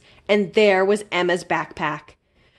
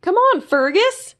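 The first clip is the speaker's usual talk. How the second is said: high, bright-eyed little critter voice; high eager sidekick calling a friend; Zeke the little creature; 11 semitones higher, 4 dB louder than usual. A narrator's reading. Zeke's voice in the story.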